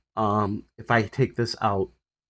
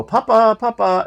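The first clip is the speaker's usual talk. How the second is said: high, loud call from outside; 11 semitones higher, 9 dB louder than usual. Retold or imitated. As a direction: call through the open window. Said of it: "and she'll go".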